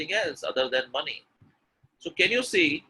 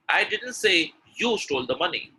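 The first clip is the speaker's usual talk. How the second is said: high, punched "you"; stress on you; 4 semitones higher than usual. Shown as a demonstration. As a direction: set "you" apart after a short pause. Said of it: "the stress is on you".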